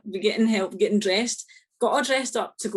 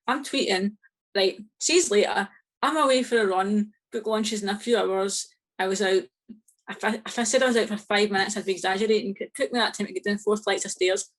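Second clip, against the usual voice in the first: ordinary talk, nothing marked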